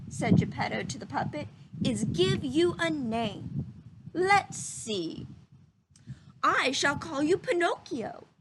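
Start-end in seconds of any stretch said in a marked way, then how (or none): 1.8-8.3 lightly voiced kindly old man; a hint of a gentle old carver; old woodcarver voice. A narrator's reading. Geppetto naming his puppet, lightly voiced old man.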